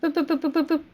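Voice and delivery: high little boop noises; boop sounds. Pretend. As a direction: pop each boop out like a click. Imitating game click sounds.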